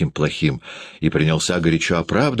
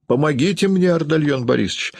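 high, urgent appeal; narrator's acted woman's cry; crying for help; 9 semitones higher than usual. A narrator's reading. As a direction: call for help at high pitch, contained volume. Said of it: "закричала Юлия".

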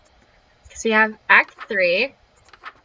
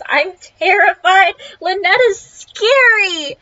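terrified; 9 semitones higher, 6 dB louder than usual. Real, not acted. Player spooked by horror game.

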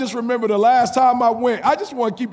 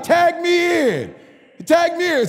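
God tagging in; 9 semitones higher, slower than usual. Acted. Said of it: "He like".

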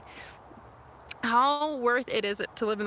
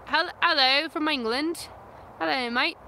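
higher, drawn-out mock English accent; put-on English accent; 6 semitones higher than usual. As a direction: greet them in a playful fake English accent. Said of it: "same accent I used when I pretended to do an Australian accent".

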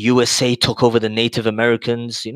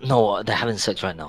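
softer, lowered voice; whispering; 3 semitones lower, 7 dB quieter than usual. Real, not acted. Telling the group about parents next door, hushed. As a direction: keep it hushed so parents don't hear. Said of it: "I'm gonna be whispering right now".

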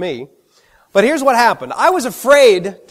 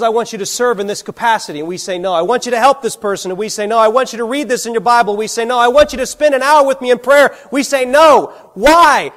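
rising steadily higher and louder; building preaching intensity; 4 semitones higher, 4 dB louder than usual. Real, not acted. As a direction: drive the refrain up to a loud, high peak. Preacher builds a repeated refrain.

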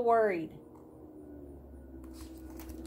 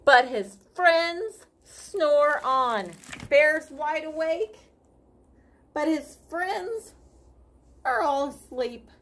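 put-on reading to children; 2 semitones higher than usual. Reading picture book aloud.

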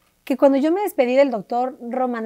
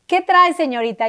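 imagined airport guard; 6 semitones higher, 4 dB louder than usual. Retold or imitated. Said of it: "Cómo voy a llevar un tumor".

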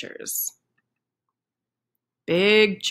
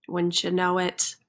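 ordinary delivery, nothing marked

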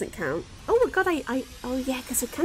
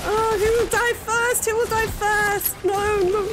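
anxious, higher and louder; nervous gamer bracing for death; panicking gamer; 8 semitones higher, 5 dB louder than usual. Real, not acted. Fears dying in game.

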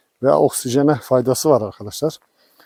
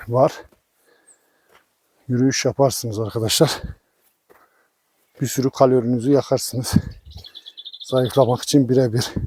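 usual speaking voice

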